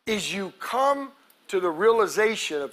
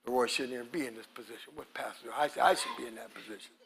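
much softer, lower, fast mutter with a laugh; jealous member muttering to himself, much softer; muttered jealous grumble; 12 semitones lower, 11 dB quieter, faster than usual. Retold or imitated. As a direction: grumble it under your breath. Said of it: "mocking a jealous member's grumble".

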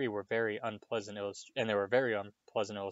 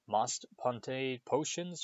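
slow, suspicious repeat; 3 semitones higher, much slower than usual. A narrator's reading. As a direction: drag it out, full of distrust. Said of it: "she repeated suspiciously".

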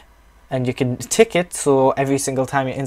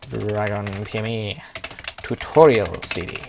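mock-grand naming; 4 semitones lower than usual. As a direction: stretch out each word of the title. Names the CD, drawing words out.

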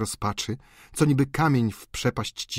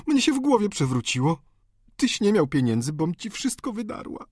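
higher-pitched, emotional woman's confession; distraught countess; 9 semitones higher than usual. Acted. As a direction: pour out the painful story in a raised pitch. Narrator voices the countess's confession.

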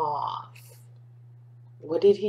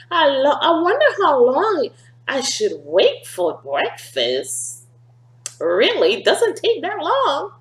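light cat voice; 8 semitones higher, 3 dB louder than usual. Acted. Cat speaks.

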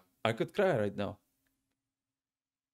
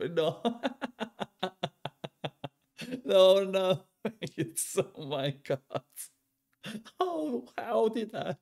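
high, giggling disbelief; laughing; 6 semitones higher than usual. Real, not acted. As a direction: laugh through the words, can't believe it. Laughing at friend dying.

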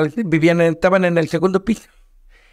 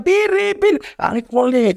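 high-pitched mock yell; imitated shouting; 8 semitones higher than usual. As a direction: imitate people yelling a nickname. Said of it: "digo que me gritan".

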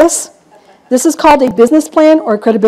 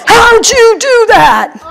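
laughing mimicry; 9 semitones higher, much slower than usual. Laughing mimicking furious investors.

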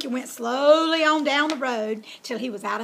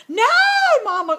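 high, loud squealed protest; squealing; 11 semitones higher, 10 dB louder than usual. A narrator's reading. Piglet protesting to his mama, high loud squeal. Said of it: "Oinky squealed".

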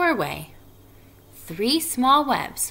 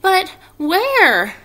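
high, loud plaintive question; Wilbur's questioning voice; 12 semitones higher, 9 dB louder than usual. A narrator's reading. Wilbur asks the departing spiders, high and plaintive. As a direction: cry it out high, stretching the question. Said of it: "asked Wilbur".

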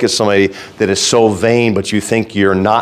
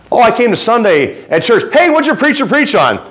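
high mock churchgoer; 12 semitones higher than usual.

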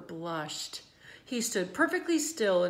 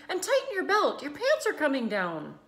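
higher call across the crowd; 7 semitones higher, 5 dB louder than usual. A narrator's reading. Mother calling to her son, higher. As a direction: call out to the boy. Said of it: "called Missus Zuckerman".

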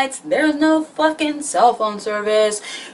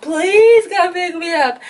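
high, slowed, desperate plea; pitched-up pleading whine; frantic begging; 9 semitones higher, 4 dB louder than usual. Retold or imitated. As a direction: beg your friend down the phone. Begging friend on phone.

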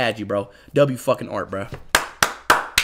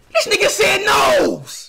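higher, louder, slowed, disbelieving yell; outraged shout; 10 semitones higher, 3 dB louder, slower than usual. Real, not acted. Speed yelling at his brother for calling it a nose, outraged. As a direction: yell it like you can't believe he said that.

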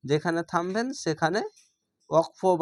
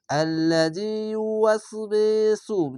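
slow chanted recitation, last word held long; teacher demonstrating tajweed; Quran recitation; 4 semitones higher, slower than usual. Shown as a demonstration. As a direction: chant it and stretch the final নাস. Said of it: "দেখো বে".

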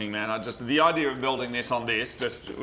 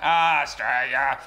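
loud, drawn-out mock Australian "Australia"; hammed-up Aussie accent, long slow vowels; 5 dB louder, slower than usual. Shown as a demonstration. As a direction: stretch out "Australia" in broad Australian. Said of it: "let's hear your Australian accent".

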